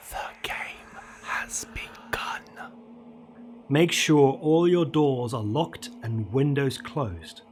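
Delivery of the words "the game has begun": slow, hushed whisper with no voice; drawn-out ghostly whisper; eerie whisper. A narrator's reading. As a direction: whisper it slowly, like something outside the door. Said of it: "hear a whisper saying".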